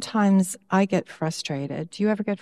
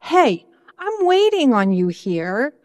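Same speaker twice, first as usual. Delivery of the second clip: raised pitch, pleading with God; higher, insistent prayer of frustration; frustrated plea to God; 5 semitones higher than usual. Retold or imitated. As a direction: complain to God, half-exasperated. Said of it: "I even say to God".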